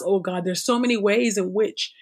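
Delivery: ordinary talk, nothing marked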